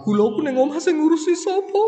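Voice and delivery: high, fast, whiny lament in a put-on voice; hammed-up weepy pilgrim voice; mock wailing pilgrim. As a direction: play the fretful pilgrim fretting over his sugar at home. Mimicking a pilgrim weeping for his sugar.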